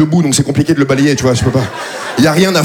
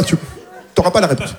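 ordinary talk, nothing marked

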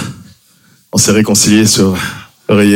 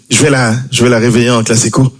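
ordinary talk

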